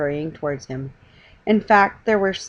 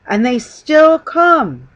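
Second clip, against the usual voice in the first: loud, very slow wonder; marvelling; 8 semitones higher, 12 dB louder, much slower than usual. A narrator's reading. Manager pointing at the crowds, loud slow delight. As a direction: savor each word in delight.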